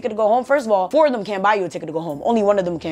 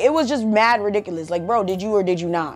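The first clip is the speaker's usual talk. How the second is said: ordinary talk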